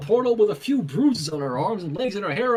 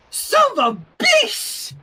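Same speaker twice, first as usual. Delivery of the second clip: angry outburst; 6 dB louder, slower than usual. A narrator's reading. Toddler's outburst at father.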